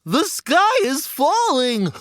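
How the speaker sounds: high, slow goose honk. Acted. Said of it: "honked Goosey Lucy".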